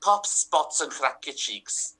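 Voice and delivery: mock-grand Scouse bard; Scouse Shakespearean. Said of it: "Scouse accent ... but also Shakespearean".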